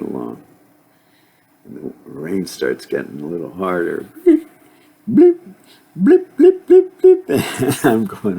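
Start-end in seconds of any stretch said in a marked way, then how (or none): 5.1-7.3 very high, louder popping blips, quick; raindrop blips. Retold or imitated. Rain getting harder, imitated with high squeaky blips.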